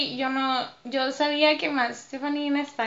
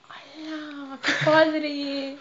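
amazed; 3 semitones higher, much slower than usual. Retold or imitated. Peeking at dancers in amazement.

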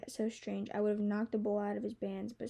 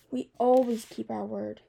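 louder, emphatic comeback; sharp retort; 8 dB louder than usual. A narrator's reading. Dragon defending his kind's honor to a girl, retorting. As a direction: push back firmly on the insult. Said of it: "he retorted".

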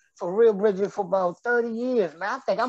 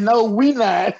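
louder, laughing through it; laughing punchline; 3 semitones higher, 4 dB louder, slower than usual. Retold or imitated. Repeating his punchline for the host, laughing louder. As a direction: deliver the punchline cracking up. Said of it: "I said".

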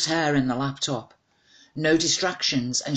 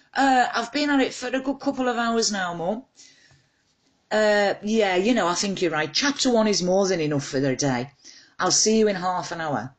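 high, eager and a bit caught out, talking to mum; flustered on the phone; 6 semitones higher than usual. Acted. A daughter on the phone to her mum, high and flustered. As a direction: sound bright and flustered, as if caught slacking.

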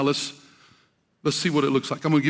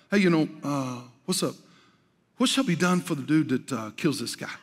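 offhand David; 2 semitones lower, 4 dB quieter than usual. Acted. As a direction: toss it off like it's no big deal. Casual retelling of David's question.

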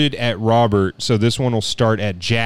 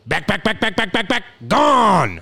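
Chris Berman; 8 semitones higher than usual. Acted. Host voices a catchphrase for guesser, rising staccato announcer impression. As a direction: build the repeats upward, then release. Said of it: "I'll do a voice for you again".

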